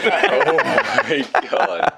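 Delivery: loud, high, drawn-out laughing reaction; laughing disbelief. Real, not acted. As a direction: crack up loudly while stretching the words out. Cracking up at the Hoskins story.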